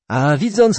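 plain reading voice

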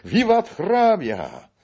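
high, loud, drawn-out cheer; roaring crowd hurrah; terrible shout. A narrator's reading. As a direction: hail him at full voice. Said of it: "straszliwy powstał krzyk za domem".

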